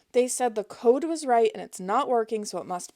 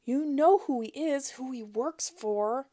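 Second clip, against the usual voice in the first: high, pleading put-on fear; mock panic; 5 semitones higher than usual. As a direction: lay the fake terror on thick. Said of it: "high-pitched panic she could muster".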